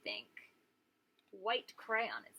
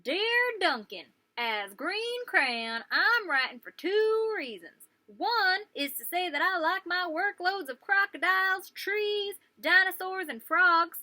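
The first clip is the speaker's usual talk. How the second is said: louder, higher, cheerful crayon voice; Green Crayon's letter; 6 semitones higher, 9 dB louder, slower than usual. Acted. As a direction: announce it proudly and happily.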